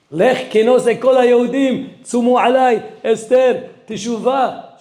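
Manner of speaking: high, energetic rallying cry; rousing. Acted. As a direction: fire them up. Preacher rousing the crowd.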